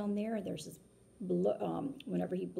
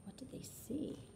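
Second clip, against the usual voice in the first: whisper; 5 semitones higher, 8 dB quieter than usual. Real, not acted. Suspense question to kids.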